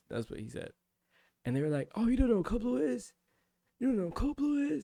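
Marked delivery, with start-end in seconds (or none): 1.9-3.1 high, sneering mock question; mock the trainee's ignorance; mocking disbelief. Instructors mock a trainee who doesn't know, in high sneering disbelief. 3.8-4.8 even higher, incredulous sneer stressing blue; mocking disbelief, pushed higher. Instructors repeat the question to the trainee in shrill mock disbelief.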